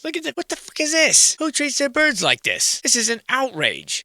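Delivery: high-pitched, hammed-up indignation; mock outrage. Explorer aghast at discarded bird cages, mock outrage.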